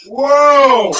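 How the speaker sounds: high, loud drawn-out whoa; slow-motion shout. Shown as a demonstration. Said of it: "super slow motion".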